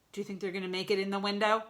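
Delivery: plain reading voice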